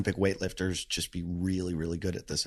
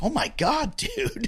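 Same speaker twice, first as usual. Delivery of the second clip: shocked high exclaim; slower than usual. Retold or imitated. Shocked reaction to blood.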